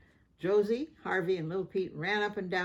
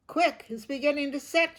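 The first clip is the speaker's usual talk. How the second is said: higher, louder urgent shout; Mom shouting; 8 semitones higher, 6 dB louder than usual. A narrator's reading. Mom warning the workers the cement sets, shouted. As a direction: call out the alarm. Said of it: "shouted Mom".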